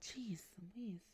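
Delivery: slow breathy whisper. Real, not acted. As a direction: whisper it under your breath. Muttered aside.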